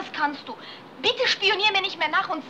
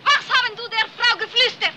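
loud, higher jealous grilling in broken German; jealous accusation; 5 semitones higher, 6 dB louder than usual. Acted. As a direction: demand an answer, suspicious and loud. Jealous wife confronts husband. (eifersüchtig und laut)

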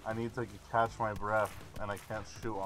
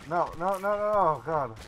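panicked; 6 semitones higher, 6 dB louder than usual. Real, not acted. Danger in game.

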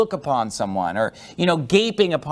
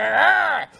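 teeth-gritting growl; 11 semitones higher than usual. Shown as a demonstration. Preacher showing the congregation an enraged teeth-grit, growling. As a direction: growl like a man grinding his teeth in rage. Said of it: "your teeth stay closed and you keep talking".

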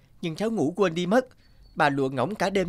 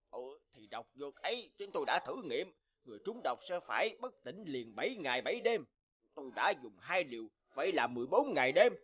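hushed, much softer conspirator talk; secret scheming; 8 dB quieter than usual. Acted. (thì thầm bàn mưu, nói nhỏ hẳn)

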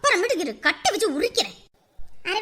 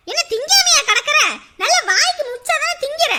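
put-on scolding child; 5 semitones higher, 4 dB louder than usual. Mother scolding her child.

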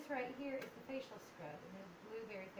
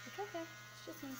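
soothing the dog; 8 semitones higher than usual. Real, not acted.

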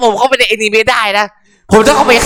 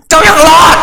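shocked shout; 7 semitones higher, 5 dB louder than usual. Real, not acted. Shocked at character surviving.

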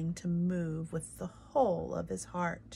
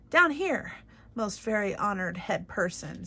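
calling up; 5 dB louder than usual. Acted. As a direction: call out from the floor to the king. Mouse calling up to the king.

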